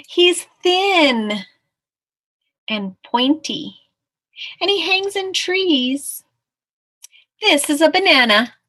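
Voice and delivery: high, loud, drawn-out pronouncement; confident storybook mouse. Acted. Mouse describing the snake to the tiger, high and certain. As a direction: present it grandly, stretching key words.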